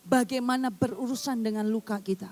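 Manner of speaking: preacher pressing the church not to complain, fervent; fervent preaching. Acted. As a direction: drive the point home to the congregation. Preacher builds to full force.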